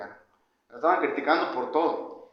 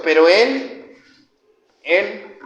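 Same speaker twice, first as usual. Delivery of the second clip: "él" louder, stretched and set apart; stress on él; 3 semitones higher, 9 dB louder, much slower than usual. Shown as a demonstration. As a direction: lean hard on the word "él". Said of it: "con acento en la E". (énfasis marcado en «él»)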